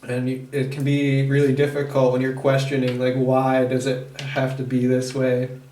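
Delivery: ordinary talk, nothing marked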